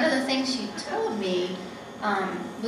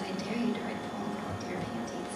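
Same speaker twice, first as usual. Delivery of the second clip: hushed quote; 2 semitones lower, 6 dB quieter than usual. Retold or imitated. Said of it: "and he said".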